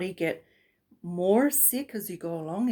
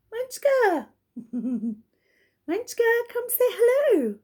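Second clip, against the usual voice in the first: high, louder sing-song call; calling the dog; 5 dB louder, much slower than usual. Real, not acted. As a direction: call your pet over with a bright high voice. Calling her dog over.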